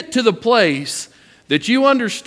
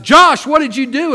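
retold sharp demand, higher and louder; mock sharp call; 7 semitones higher, 6 dB louder than usual. Dad calling out to son after the dog yelps, raised sharp voice. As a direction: call the boy out like an alarmed dad. Father retelling scolding his son.

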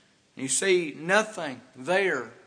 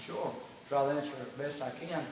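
gentle father; 3 semitones lower, 10 dB quieter than usual. Acted. Father voiced softly.

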